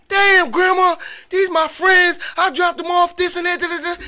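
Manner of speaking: high-pitched mock protest to grandma; high pleading grandson. Grandson explaining himself to his grandma, a high mock plea. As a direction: squeak out the excuse like a caught kid.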